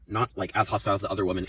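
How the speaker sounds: ordinary delivery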